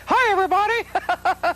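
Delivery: high squeaky falsetto mouse; Mickey Mouse voice. Shown as a demonstration. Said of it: "I have done Mickey's voice".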